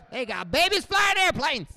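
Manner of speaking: high, loud outraged grumble; indignant old man. Retold or imitated. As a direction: bark it like a scandalized old veteran.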